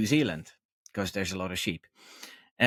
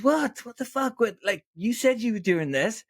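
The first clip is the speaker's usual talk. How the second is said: high, mock-outraged What the fuck; a hammed-up shout of disbelief, pitched high; imitating a stunned shepherd caught out; outraged shock; 11 semitones higher than usual. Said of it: "And he goes".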